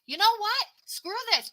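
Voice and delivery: high, sudden "screw this" defiance; fed-up outburst. Pretend. Reader voicing someone finally refusing to settle, fed up. Imagined self-talk standing up for yourself.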